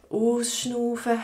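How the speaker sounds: slow, drawn out, breathing out after it; breathing-exercise demo. Shown as a demonstration. Teacher showing learners how to breathe out, slow breathing demo. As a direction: stretch the word to guide an exhale.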